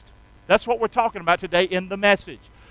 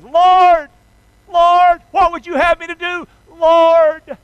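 1 Saul crying out; 8 semitones higher, 8 dB louder, slower than usual. Acted.

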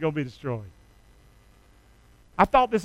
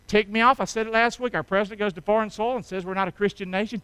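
normal talking voice

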